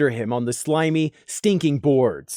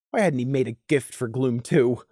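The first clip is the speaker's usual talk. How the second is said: plain reading voice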